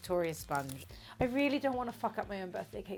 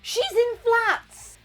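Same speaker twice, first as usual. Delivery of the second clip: high, drawn-out mock shock; mock outrage; 9 dB louder, much slower than usual. Real, not acted. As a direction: gasp it out in disbelief.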